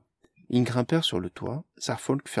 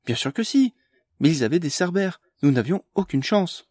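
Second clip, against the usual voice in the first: agitated; 6 semitones higher, 6 dB louder than usual. A narrator's reading. Amélie retorts, upset.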